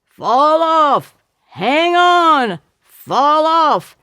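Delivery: high loud alternating shouts; two boys yelling over each other; yelling back and forth. Acted. Two boys shouting at the girl riding the pig, high and loud. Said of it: "The others yelling".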